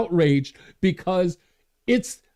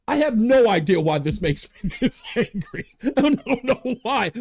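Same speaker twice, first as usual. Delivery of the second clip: laughing at himself. Real, not acted. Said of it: "I'm laughing at myself".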